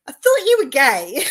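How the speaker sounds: loud, high, bursting into laughter; amused disbelief, loud and high; laughing exclamation. Retold or imitated. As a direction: blurt it out through a laugh. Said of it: "And I went".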